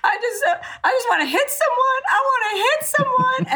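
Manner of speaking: high, half-laughing, half-sobbing quote; laugh-crying imitation. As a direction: mimic hysterical grief that tips into laughter. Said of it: "she's crying".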